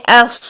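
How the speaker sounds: ایس ending in a long heavy hiss; full-mouthed saad. Shown as a demonstration. Teacher showing saad without a vowel, ایس thick and held. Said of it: "منہ بھر کے".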